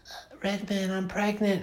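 high put-on whiny voice; mocking Jennifer Tilly. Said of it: "where she's like".

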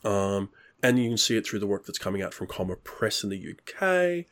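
ordinary delivery

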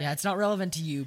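playful loud comeback; loud fast retort. Real, not acted. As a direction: snap back loud and quick.

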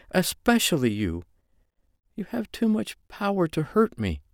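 high woman's voice pleading she can't; pained woman. Acted. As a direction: plead with him, torn and hurting. Female character's pained confession continued.

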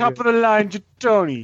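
high-pitched mock Italian accent; fake Italian accent. Bragging about the table, fake Italian accent. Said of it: "With a fake Italian accent".

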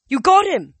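high, loud amazed shout; excited. A narrator's reading. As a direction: cheer in surprise. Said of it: "Zoe said".

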